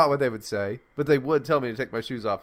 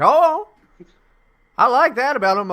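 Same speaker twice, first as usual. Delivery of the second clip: very high, loud exclamation of approval; hammed-up guard suddenly won over; TSA agents interrupting; 11 semitones higher, 9 dB louder than usual. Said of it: "And they're like".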